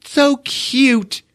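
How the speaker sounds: high, slow gushing mom voice; mock mother cooing over cute pajamas; imitating mom. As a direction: coo like a doting mother. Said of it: "the mom is just like".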